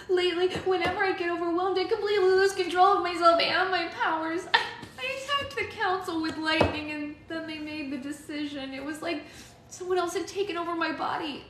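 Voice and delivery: put-on distressed girl confessing; young girl voice. A narrator's reading. Alex confessing.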